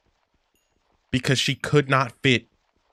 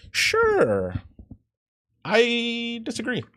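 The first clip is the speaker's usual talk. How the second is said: sarcastic dismissal; 12 semitones higher, much slower than usual. Real, not acted.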